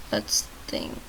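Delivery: whispered, low and soft; whispered musing. Real, not acted. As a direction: mutter it under the breath. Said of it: "the reason I said "let's think".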